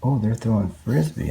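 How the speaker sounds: usual speaking voice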